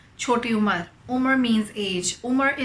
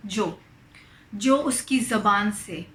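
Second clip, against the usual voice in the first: ordinary talk, nothing marked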